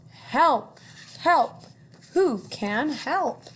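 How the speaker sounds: high, loud desperate cries for help; duck crying for help. Acted. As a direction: call out like a panicking stuck duck. Duck calling for help in story.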